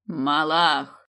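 a loud, drawn-out call; shouted summons. Acted. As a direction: cry the serpent's name out. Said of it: "как закричал Давлат".

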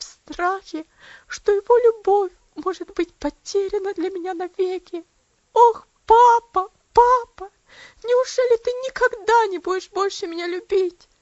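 high, loud, sobbing lament rising to a wail on папа. A narrator's reading. Girl sobbing to her aunt about losing her father's love, high wailing sobs. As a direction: break down crying for her father. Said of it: "зарыдала она".

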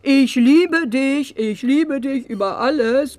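drawn-out swooning lovesick voice, mocking; mock-romantic slow recital; mock lovesick reading. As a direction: read the love note like a sappy fool, slow. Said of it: "Da stand".

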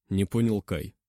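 plain reading voice

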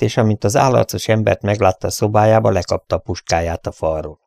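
plain reading voice

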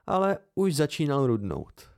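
ordinary delivery, nothing marked

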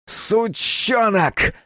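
high, drawn-out furious cry; stretched, high-pitched curse; helpless fury. A narrator's reading. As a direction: spit the insult in impotent rage. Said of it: "выкрикнул Капустин в бессильной злобе".

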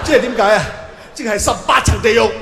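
loud shocked shout; a damned man shouting his horror at the verdict. Acted. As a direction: yell it out in disbelief.

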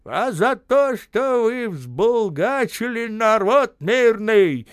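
put-on loud, high, slow scolding old man. A narrator's reading. Indignant old man scolding the men. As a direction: scold them with conviction, slowly.

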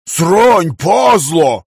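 sharp startled yell. Acted. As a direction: shout the curse out. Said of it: "заорал Вася".

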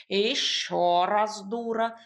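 slow, growly cat voice; grumbling cat. A narrator's reading. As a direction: grumble the insult, dragging it out. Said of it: "проворчал кот".

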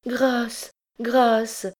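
high-pitched cries for mercy; frantic, high-pitched plea; terrified begging. A narrator's reading. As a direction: plead desperately for your life. Girl begs the recluse for mercy.

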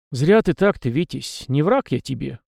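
plain reading voice

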